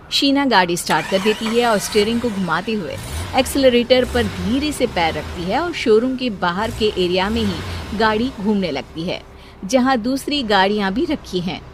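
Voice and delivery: story narration. A narrator's reading.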